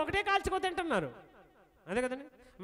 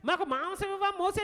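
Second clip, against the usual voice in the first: higher, hammed-up crying plea; mock wailing; 6 semitones higher than usual. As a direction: wail it like a spoilt child. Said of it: "అని ఏడుస్తున్నారు".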